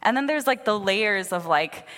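normal talking voice

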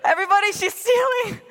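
mock store alarm. The whole store calling her out, high loud mock alarm. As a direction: cry it out like the whole shop is pointing. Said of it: "the whole store is like".

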